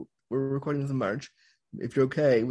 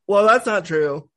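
loud, slow dismissive retort; 6 semitones higher, 9 dB louder, slower than usual. Retold or imitated. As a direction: brush her off, loudly and slowly. Community dismissing her story.

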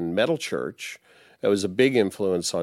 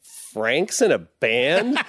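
slow, laughing, higher mock disbelief; laughing disbelief, higher and slower; amazed disbelief; 5 semitones higher, slower than usual. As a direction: stretch it out laughing in surprise. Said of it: "And she's like".

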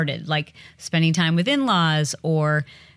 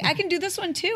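imitating proud husband; 11 semitones higher, faster than usual. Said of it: "And he's like".

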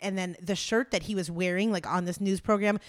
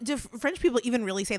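normal talking voice

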